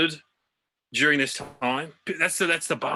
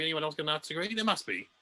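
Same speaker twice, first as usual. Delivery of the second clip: usual speaking voice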